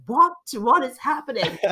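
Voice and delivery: loud, high mock disbelief, laughing; hammed-up baffled outburst; mock bewilderment. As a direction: act out a baffled mind, amused. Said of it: "their mind just goes".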